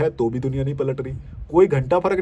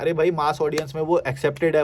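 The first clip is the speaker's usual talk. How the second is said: normal talking voice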